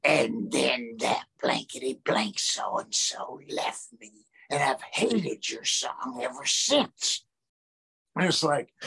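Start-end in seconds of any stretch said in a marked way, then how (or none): 0.0-7.2 slow, lowered, menacing snarl; slow bitter growl of a jilted fan; sudden demonic voice. Retold or imitated. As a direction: let her turn into something dark and bitter. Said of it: "a voice I didn't recognize".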